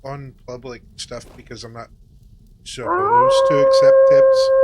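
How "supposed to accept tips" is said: high excited squeal, much louder. Real, not acted. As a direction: squeal it out, thrilled. Squeals about getting a tip.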